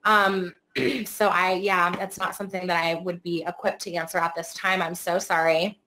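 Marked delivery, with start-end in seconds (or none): none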